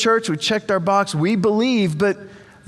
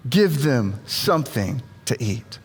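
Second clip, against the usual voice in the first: low, unhurried instruction with spaced words; measured instruction; 8 semitones lower than usual. Acted. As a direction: say "give them something to eat" low, word by word. Jesus' instruction, first take.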